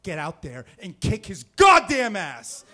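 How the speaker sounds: loud shouted order; father shouting at his son to fight, loud; dad yelling. Retold or imitated. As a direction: holler it like a dad egging on his kid. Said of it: "my dad says".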